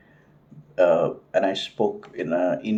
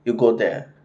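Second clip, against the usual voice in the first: imitated rude saleswoman; 3 semitones higher, 4 dB louder than usual. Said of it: "the Thai lady was like".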